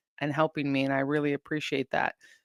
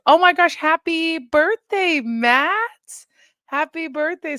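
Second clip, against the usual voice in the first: high, bright squeal of happy birthday, much higher and louder; excited birthday greeting; 12 semitones higher, 5 dB louder than usual. Real, not acted. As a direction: gush it out to a fan.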